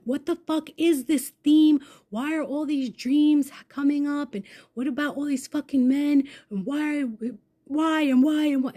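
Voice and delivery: high, loud exasperated rant; fuming. Retold or imitated. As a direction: fire off mounting questions, worked up in the retelling. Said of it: "I wake up fuming".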